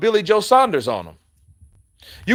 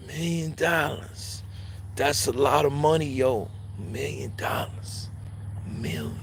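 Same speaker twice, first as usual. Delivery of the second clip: slow, much softer repeated plea; mock pleading mumble; 10 dB quieter than usual. Andrade begging Eubank Junior, slow and hushed. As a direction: beg under your breath, slowly. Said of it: "begging Chris Eubank Junior".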